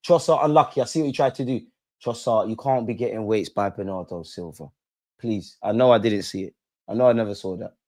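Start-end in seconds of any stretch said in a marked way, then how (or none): none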